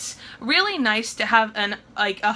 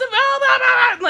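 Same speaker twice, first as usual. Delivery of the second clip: mock ranting; 12 semitones higher, 4 dB louder than usual. Mimicking paladin's ranting.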